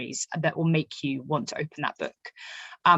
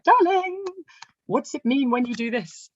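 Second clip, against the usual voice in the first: high sing-song darling, voice pitched up; calling to husband; 9 semitones higher than usual. Acted. Writer calls to her vet husband with a research question, wheedling. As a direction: wheedle a favour in a high, put-on sweet call.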